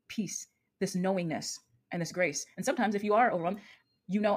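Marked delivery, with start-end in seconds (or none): none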